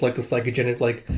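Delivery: usual speaking voice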